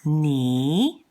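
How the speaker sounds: drawn-out "ni" with a rising tone; second tone. Shown as a demonstration. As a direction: slide "ni" upward like a question. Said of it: "The next one is".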